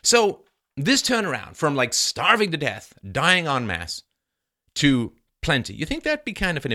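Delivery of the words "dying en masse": ordinary talk, nothing marked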